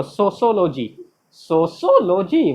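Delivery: SOCIOLOGY as so-so-lo-jee; wrong pronunciation. Shown as a demonstration. Teacher mocks the common answer, सोशियोलॉजी as "so-so-lo-jee". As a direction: say सोशियोलॉजी as a plain so-so plus lo-jee. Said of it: "बोलते हैं किस में honours कियो?".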